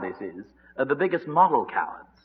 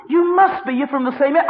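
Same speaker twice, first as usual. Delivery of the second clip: high, loud accusation; acted pointed insistence; insistent accuser; 12 semitones higher, 5 dB louder than usual. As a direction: press him hard, voice pitched up. Acted accusers pressing Peter.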